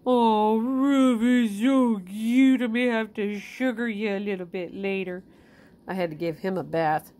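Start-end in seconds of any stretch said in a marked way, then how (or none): 0.1-4.2 cooing. Real, not acted. Owner gushing over Ruff, slow and adoring. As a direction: gush slowly over the dog.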